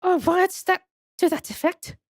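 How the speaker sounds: put-on high-pitched madam with French accent; French museum madam. Madam explaining the waxwork's business proposal, high French accent. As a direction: play her high and French.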